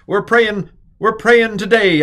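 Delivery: high, loud mock preacher cadence; fervent prayer voice. Teacher imitating someone praying to explain a particle, hammed-up fervour. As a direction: belt it out like a revival prayer.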